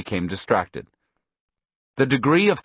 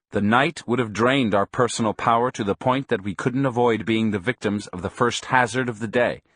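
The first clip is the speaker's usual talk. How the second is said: usual speaking voice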